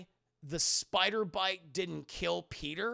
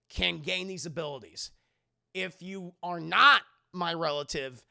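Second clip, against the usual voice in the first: ordinary talk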